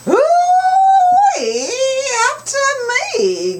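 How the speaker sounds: high, loud whoop; loud squealing ooh-wee; excited Grandma. Acted. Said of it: "Grandma said".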